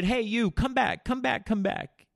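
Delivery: two guys chasing after a man, calling. Retold or imitated. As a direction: shout them back, slow and high. Guys calling him back.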